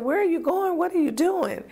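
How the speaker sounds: high, alarmed retelling of the panic; reliving the fear, pitch shooting up; panicked questions. Retold or imitated. Trapped in the car asking the driver, high panic.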